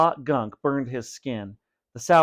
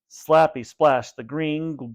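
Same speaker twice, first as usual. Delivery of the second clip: usual speaking voice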